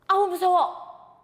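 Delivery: scared cry. Acted. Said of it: "라고 얘기하는 그 순간".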